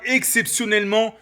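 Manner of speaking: ordinary talk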